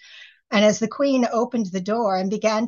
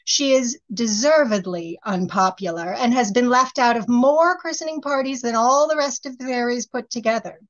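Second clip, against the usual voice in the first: ordinary delivery, nothing marked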